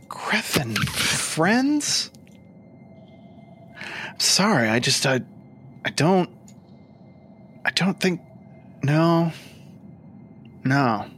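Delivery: high, rising disbelief; doubtful Lich. Acted. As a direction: struggle to believe it.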